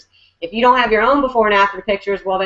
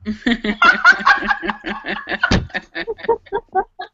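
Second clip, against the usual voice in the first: real laugh; 11 semitones higher than usual. Real, not acted. Laughing after her joke.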